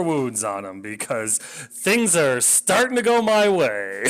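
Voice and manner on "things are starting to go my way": loud, high-pitched mock optimism; drawn-out sarcastic cheer. Real, not acted. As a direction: raise pitch and volume in bitter irony. Sarcasm about a disastrous turn.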